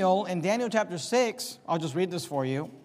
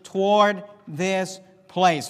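"toward" leaned on, slow and louder; slow down and hit "toward this place"; emphatic preacher, "toward" weighted; stress on TOWARD; 4 dB louder, slower than usual. Shown as a demonstration. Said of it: "notice the emphasis here".

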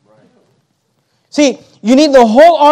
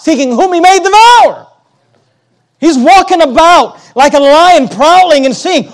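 loud, high-pitched pulpit delivery; full-force preaching; 4 semitones higher, 4 dB louder than usual. Acted. Preacher warning the congregation of the devil, full-force preaching. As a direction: build to a ringing scriptural warning.

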